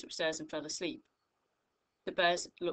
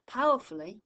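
Powerfully said higher and louder; emphasised adverbial; 4 semitones higher, 9 dB louder than usual. Shown as a demonstration. Teacher modelling the fronted adverbial for pupils, higher and louder. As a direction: punch out Powerfully.

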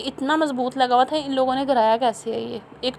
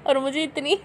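laughing; 3 semitones higher, 7 dB quieter than usual. Real, not acted. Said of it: "इतनी हंसी आ रही थी".

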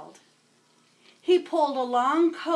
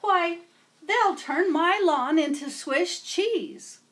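higher, louder fretful gardener; upset gardener; 7 semitones higher, 6 dB louder than usual. Acted. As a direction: fuss like a worried gardener. Gardener upset about gophers.

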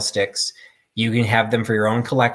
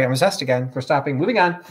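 normal talking voice

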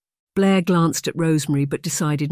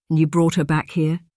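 normal talking voice

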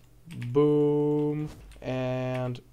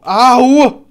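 pained yelp; 7 semitones higher, 10 dB louder than usual. Real, not acted. Hurt in game.

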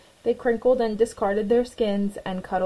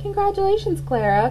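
higher and louder, delighted; cheering; 11 semitones higher, 4 dB louder than usual. A narrator's reading. Butterflies praise Clara, bright and loud. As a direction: cheer for the hero. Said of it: "complimented the other butterflies".

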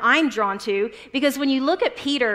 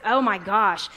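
ordinary talk

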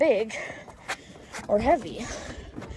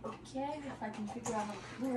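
whispering; 15 dB quieter than usual. Real, not acted. Hushed while setting up.

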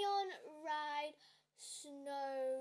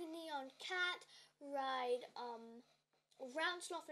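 ordinary talk, nothing marked

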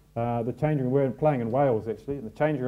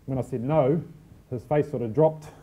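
ordinary talk

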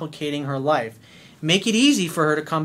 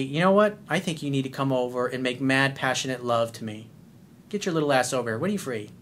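lower, soft seductive voice; seductive invite; 3 semitones lower, 3 dB quieter than usual. Shown as a demonstration.

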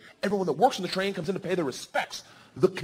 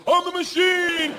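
much higher, louder, slow shout; shouted boast; 14 semitones higher, 5 dB louder, much slower than usual. Retold or imitated. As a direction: roar it proudly. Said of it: "all I knew how to say".

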